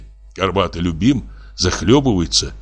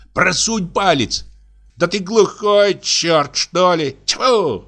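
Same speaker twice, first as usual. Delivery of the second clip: high, squeaky scolding; exasperated squealing tenor; 10 semitones higher, slower than usual. A narrator's reading. Fisherman cursing his deaf mate, high and exasperated. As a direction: squeal it at a slow-witted mate, drawn out.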